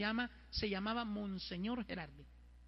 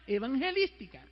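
loud, high, slow announcer read; mock poster announcement; 6 semitones higher, 11 dB louder, slower than usual. Priest reading the protestant poster aloud, mock announcer voice. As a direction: proclaim it like a billboard. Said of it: "Decía".

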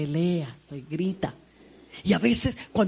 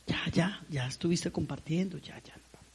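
hushed urging; 3 semitones lower, 9 dB quieter than usual. Retold or imitated. Hushing daughter at wedding.

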